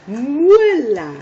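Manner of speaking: Muela called out high and loud; loud model word. Shown as a demonstration. Teacher modeling the sound to kids, loud. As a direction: belt out Muela for them to copy. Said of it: "hagamos el sonido".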